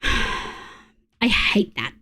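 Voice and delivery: exhaled on a sigh, slow and airless; fed-up sigh under the breath; sighed, breathless. Real, not acted. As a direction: let it out as a tired sigh. Frustrated at mod override.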